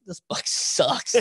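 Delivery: slow, breathy mock despair; disgusted with own book. Reading his first draft, whispering to himself in mock despair. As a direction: drag it out in a half-whisper of disgust. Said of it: "I'm just like".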